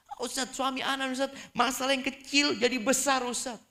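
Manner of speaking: high, whining wife's voice; whiny complaining wife. Acted. Wife grumbling to the ustaz about her husband, high and whiny.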